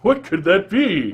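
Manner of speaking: high, loud, slow bewilderment; mock wonder. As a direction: draw it out in hammed-up puzzlement. Feigned wonder at mystery voice.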